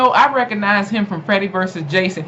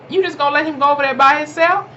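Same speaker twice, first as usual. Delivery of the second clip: higher, fast incredulous question; 8 semitones higher, faster than usual. Retold or imitated. Parents questioning the nanny, higher and fast in disbelief. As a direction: ask it in disbelief. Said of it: "they was looking at her like".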